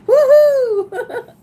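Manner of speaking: high, loud, laughing cheer of joy; a squealing, laughing victory cheer; excited woohoo. Real, not acted. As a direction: whoop it out in celebration. Celebrating ten thousand subscribers.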